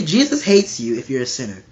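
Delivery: normal talking voice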